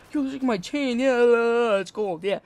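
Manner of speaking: goofy mock boasting, rising then dropping off; chain-proud friend. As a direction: imitate a friend crowing about his chain. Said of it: "He's like, every day".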